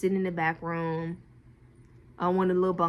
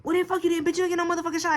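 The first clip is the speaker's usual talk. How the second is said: high mock rude man; 9 semitones higher, faster than usual. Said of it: "He was like".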